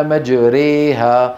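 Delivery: مجريها drawn out slowly, the ra and alif leaned toward an e sound; imala demonstration. Shown as a demonstration. Teacher showing students the imala, مجريها slow and leaned. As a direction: stretch مجريها slowly with the ra tilted to e. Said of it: "إمالة فتحة الراء وإمالة الألف".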